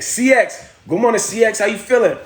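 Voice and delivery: high-pitched hail to a newcomer; excited greeting. Real, not acted. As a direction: call out a lively hello. Hailing a viewer who joins.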